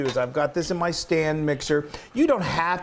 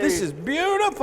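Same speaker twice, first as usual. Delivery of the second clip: delighted; 12 semitones higher than usual. Real, not acted. Admiring the dough.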